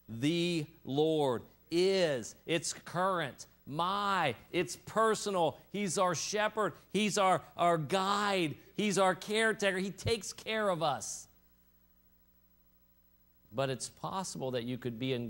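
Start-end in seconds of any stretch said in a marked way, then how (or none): 0.1-2.4 "The Lord is" very slow, each word set apart; emphatic stress. Shown as a demonstration. Preacher stressing words of the psalm, "The Lord is" drawn out. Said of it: "we emphasized the word". 2.5-11.3 high, punchy declarations in short bursts; emphatic preaching. Real, not acted. Preacher telling the congregation he's our shepherd, forceful and high.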